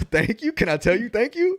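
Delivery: high, hesitant recruit voice; stunned recruit thanks the deputy, high and hesitant; timid mock thanks. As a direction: thank him like you're unsure you're allowed.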